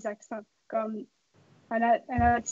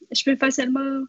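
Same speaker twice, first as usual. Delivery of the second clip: usual speaking voice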